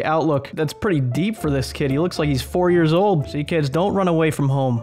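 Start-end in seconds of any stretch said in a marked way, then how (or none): none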